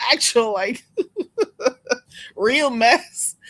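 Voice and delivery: words broken up by giggles; helpless giggling between words; laughing. Real, not acted. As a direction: crack up while talking. Cracking up over mess she watches.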